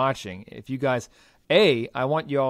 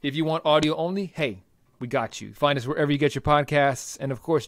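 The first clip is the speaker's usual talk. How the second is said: ordinary delivery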